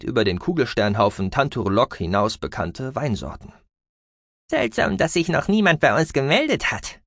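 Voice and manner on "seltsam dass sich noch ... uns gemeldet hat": high squeaky mouse voice, uneasy; uneasy little alien squeak; squeaky creature voice. A narrator's reading. Gucki the mouse-beaver speaks.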